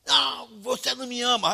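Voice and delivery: high, loud accusing wail; drunken outburst. Retold or imitated. Drunk friend's outburst, acted.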